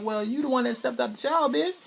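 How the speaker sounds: high, comic retort in a changed voice; imitating the hero's retort after the shower; put-on voice. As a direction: snap back in a silly high voice. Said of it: "He's like".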